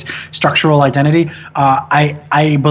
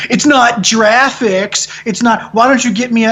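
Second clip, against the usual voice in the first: hipster dude; 7 semitones higher than usual. Retold or imitated. Imitating a meme video dude arguing pronunciation, mock hipster. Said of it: "the sort of hipster dude going".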